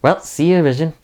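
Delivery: sing-song sitcom sign-off; hammed-up neighborly farewell; sitcom chirp. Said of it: "Herb is like".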